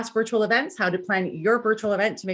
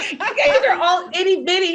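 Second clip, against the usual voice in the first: laughing; 7 semitones higher, 4 dB louder than usual. Real, not acted. Joking about being a giant.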